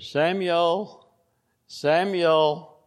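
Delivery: lightly voiced divine call; a hint of God calling.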